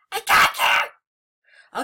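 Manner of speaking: slow, loud drawn-out gloat; gloating shout. Real, not acted. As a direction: stretch it out and gloat.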